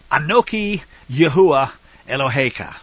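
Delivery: loud, deliberate Hebrew reading; reciting in Hebrew. Shown as a demonstration. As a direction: read the Hebrew out firmly, word by word. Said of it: "I'll read it in Hebrew first".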